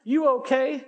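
lightly voiced older lady, slow and tender; a hint of a kindly older lady asking gently. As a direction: ease into a caring older woman's voice, drawn out. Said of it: "She says".

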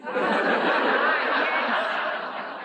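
breathy unvoiced laugh. Real, not acted. Preacher to church, real laughter. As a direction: laugh out at the excuse.

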